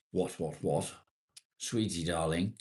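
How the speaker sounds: lightly put-on posh English accent; mock posh accent. Streamer joking about having a posh accent, mock posh. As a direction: play at being posh for a laugh. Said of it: "Do I have a posh accent?".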